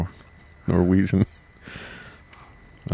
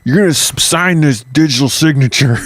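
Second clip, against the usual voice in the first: higher-pitched, exasperated mock order; mock angry demand; 5 semitones higher, 3 dB louder than usual. Nazi delivery man demanding a signature, mock angry. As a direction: demand it like a fed-up enforcer.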